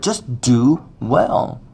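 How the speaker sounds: drawn out, each word weighted; slow it right down to press the lesson home; slow, weighty exhortation; slow emphasis. Real, not acted. Preacher stresses the lesson.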